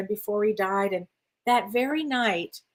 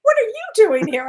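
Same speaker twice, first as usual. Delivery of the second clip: retold startled question, much higher and louder; surprised; 11 semitones higher, 7 dB louder than usual. Dead father finding his daughter in the afterlife, acted surprise. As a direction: act out being caught off guard by a visitor.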